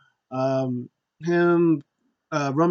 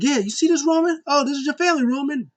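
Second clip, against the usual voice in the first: higher, loud taunting wrestler voice; taunting Kevin Owens; 12 semitones higher, 4 dB louder than usual. Retold or imitated. Wrestler taunting his rival, higher jeering voice. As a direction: jeer at Roman like a heel. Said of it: "He's like".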